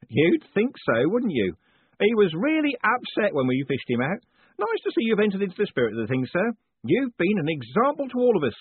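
a beaming prison warden teasing his prisoner; jovial warden. A narrator's reading. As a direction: play the cheerful warden gloating kindly. Warden chats cheerfully.

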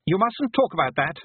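put-on high, urgent child voice; agitated boy. A narrator's reading. Frightened boy forbids talk.

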